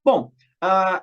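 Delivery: usual speaking voice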